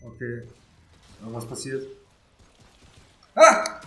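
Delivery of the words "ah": high, loud sudden yelp; startled scream. Real, not acted. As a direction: shriek in shock. Something sudden happens in game. (erschrockener Schrei)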